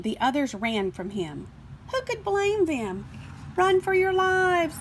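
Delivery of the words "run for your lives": panicked shout. Acted. Numbers fleeing in panic.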